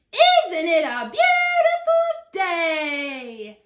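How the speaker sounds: slow, singing, high-pitched call. Acted. Tumbleweed hailing grumpy cactus, calling out in singsong. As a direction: sing it out cheerfully, stretched and loud. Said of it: "Rosie the tumbleweed called out".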